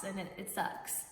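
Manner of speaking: ordinary delivery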